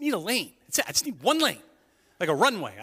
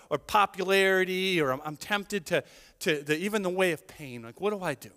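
normal talking voice

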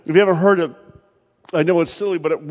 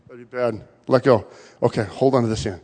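ordinary delivery

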